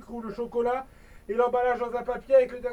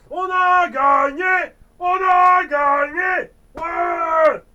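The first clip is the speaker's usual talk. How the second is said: victory shout; 7 semitones higher, 11 dB louder, much slower than usual. Acted. Winning the sandcastle contest.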